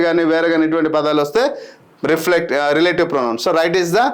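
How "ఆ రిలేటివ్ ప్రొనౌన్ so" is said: ordinary delivery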